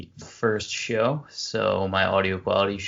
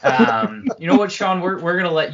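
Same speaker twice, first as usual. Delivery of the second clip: higher, louder, breaking into a laugh; 9 semitones higher, 5 dB louder than usual. Real, not acted. Co-host addressing Sean after a ridiculous take, laughing. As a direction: talk through real laughter. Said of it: "can't say this without laughing".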